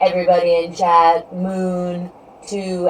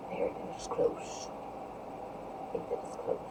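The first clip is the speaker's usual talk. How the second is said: very soft voiceless whisper; 20 dB quieter than usual. Real, not acted. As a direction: whisper it as if to yourself. Murmuring an unsure guess to self.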